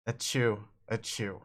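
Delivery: mock sneeze sounds; sneezing. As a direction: fake sneeze. Sprite sneezes.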